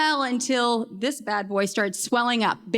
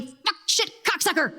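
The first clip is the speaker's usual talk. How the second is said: retold panicked swearing; 6 semitones higher than usual. Swearing at moment of impact, retold.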